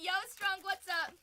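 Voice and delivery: high, loud hail to a viewer; calling out. Real, not acted. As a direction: call out an excited greeting.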